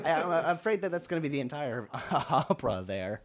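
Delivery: laughing while talking; words through a laugh. Real, not acted.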